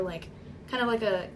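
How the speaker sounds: ordinary talk, nothing marked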